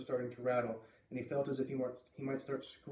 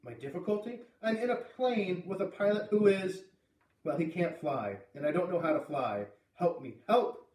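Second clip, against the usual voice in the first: incredulous start, then loud pleading; desperate plea; 4 semitones higher, 4 dB louder than usual. Acted. Boy telling radio voice he can't fly, desperate. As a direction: blurt out the trouble and beg for help.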